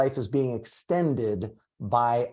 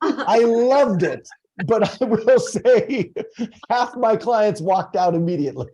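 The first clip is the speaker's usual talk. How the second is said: laughing; 8 semitones higher than usual. Real, not acted. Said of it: "laughing while telling".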